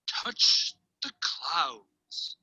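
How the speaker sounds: very slow, drawn-out, low words; dreamy name try-out. Acted. Boy who climbed a mountain trying a new name, slow hushed delivery. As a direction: stretch each word out slowly.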